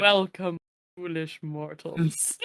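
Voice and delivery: low, slow, ominous welcome; spooky ghost host. Pretend. Haunted Mansion ghost host intro.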